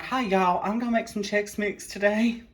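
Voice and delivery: mock Southern cooking host, a touch high; hint of a Southern grandma greeting; Southern lady. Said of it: "that's me right now".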